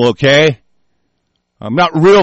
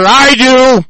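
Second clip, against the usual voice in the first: sudden loud, higher cry of defiance; defiant shout; 7 semitones higher, 6 dB louder than usual. Real, not acted. Answering doubters who fear a Marine sniper, defiant shout.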